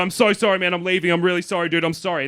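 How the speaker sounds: mock-panicked sorry; flustered apology. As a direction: apologise over and over, easing down. Panicked apology while leaving.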